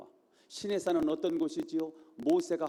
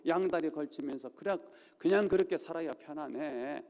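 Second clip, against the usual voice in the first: normal talking voice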